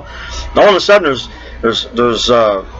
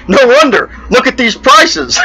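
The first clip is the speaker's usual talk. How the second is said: punchline delivery; 10 semitones higher than usual. Acted. Gorilla's punchline.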